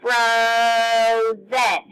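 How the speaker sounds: "Frozen" drawn out on the O; slow frooo-zen for "Frozen"; stretched-out vowel. Shown as a demonstration. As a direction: sustain the O of "Frozen" before the zen. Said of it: "I was holding out the O".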